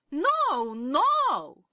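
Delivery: high, loud double no; yelling. A narrator's reading. Princess yells down her squabbling sisters, high loud. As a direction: yell down the other sisters. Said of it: "yelled Turquoise".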